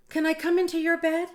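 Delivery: high, loud and quick mouse plea; eager Little Mouse. Acted. Little Mouse asks, faster.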